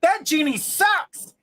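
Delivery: high, drawn-out complaint; pissed off, disappointed. Acted. Man back at the bar after a botched wish, pissed off. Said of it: "Pissed off and disappointed".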